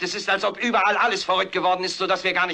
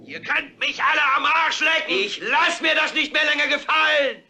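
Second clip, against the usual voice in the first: screaming out the window; 8 semitones higher than usual. Acted. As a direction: roar it at the whole city. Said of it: "schreit und brüllt".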